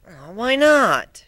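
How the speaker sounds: drawn-out, very slow pouty whine; pouting. Acted. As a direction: Twilight denied a look at the magic, pouting. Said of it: "she pouted".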